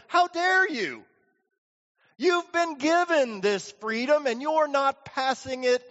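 high-pitched, acted outrage; indignant rebuke. Original master confronts the unforgiving slave, indignant.

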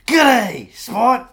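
put-on Australian accent instead of Irish; a cheery Aussie greeting passed off as Irish; hammed-up Australian accent; mock Irish accent. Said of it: "do you do an Irish accent?".

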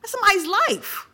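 high-pitched, impassioned insistence. Real, not acted. Impassioned plea about people being killed.

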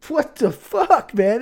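high, loud, slow outraged what the fuck; indignant disbelief. Real, not acted. To his friend who calls the rocket feat unimpressive, indignant disbelief. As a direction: stretch it out in mock outrage.

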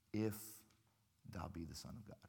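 sly devil. Retold or imitated. Devil tempting Jesus in the wilderness, low sly taunt. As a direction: let the doubt creep in quietly. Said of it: "what's the first thing the devil says".

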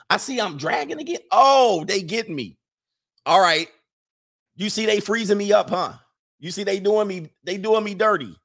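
high-pitched playful protest; aggrieved complaining. Real, not acted. Complains about being caught frozen on screen.

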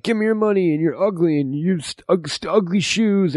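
high, put-on goofy thug voice; silly robber voice. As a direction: play the robber as a squeaky cartoon bully.

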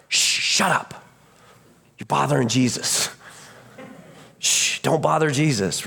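mock hushing of a nuisance; shushing. Crowd to the yelling beggar, shushing him. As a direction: shush him, then scold in short bursts. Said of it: "And they're like".

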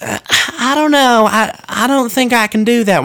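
high, hesitant mock refusal; timid shop lady. Baker refusing a one-cent donut, timid and unsure. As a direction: dither nervously like a shy clerk. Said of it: "And she was like".

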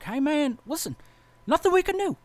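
retold officer, high put-on voice; high-voiced officer. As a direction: play the officer kindly, voice pitched high. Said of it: "superior officer's like".

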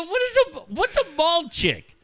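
very high, drawn-out baffled question; baffled dad. Retold or imitated. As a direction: ask it in high-pitched mock bewilderment. Baffled dad questioning his son.

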